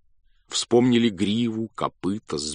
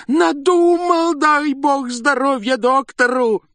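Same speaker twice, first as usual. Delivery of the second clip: much higher, slow, loud cry of joy; joyful shout; 7 dB louder, slower than usual. A narrator's reading. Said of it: "не своим голосом".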